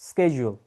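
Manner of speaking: schedule said as "SKE-jul"; crisp "sk" start on schedule, falling; correct pronunciation. Shown as a demonstration. Teacher answering a learner's question, schedule as "SKE-jul". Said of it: "right way to pronounce this word".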